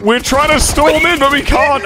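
high, drawn-out protest; exasperated protest. Real, not acted. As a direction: argue back in a high, strained pitch. Squabbling back during game.

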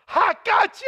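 high, loud triumphant "gotcha"; gotcha shout. Retold or imitated. Airport officer revealing the prank, high triumphant shout. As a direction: yell it like you sprang the trap.

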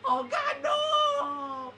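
high-pitched cry of dismay; frantic high gamer despair; panicked wail. Real, not acted. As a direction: wail as it all goes wrong. Losing in game.

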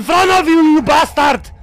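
angry shout. Real, not acted. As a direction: yell at the enemy. Shouting at enemy.

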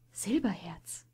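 whispered softly in wonder; awed whisper; breathed whisper. A narrator's reading. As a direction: breathe the name, barely voiced. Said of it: "hauchte Tufur".

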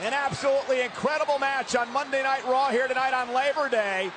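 ordinary talk

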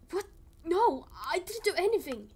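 loud, high outraged denial; shouted denial. Acted. Accused man yells his innocence at the crowd, loud. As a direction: shout it down in disbelief.

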